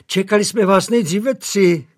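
a hint of a fussing housemaid; eager maid. A narrator's reading.

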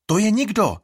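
high, loud scornful cry; exclaiming. A narrator's reading. Vigo exclaiming Iris is nobody. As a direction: call it out dismissively. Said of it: "zvolá Vigo".

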